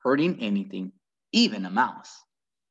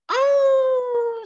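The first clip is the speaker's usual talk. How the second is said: a high, loud drawn-out dog howl; 7 dB louder than usual. Acted. Winn-Dixie howling from outside the church, high and loud. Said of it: "said Winn-Dixie back".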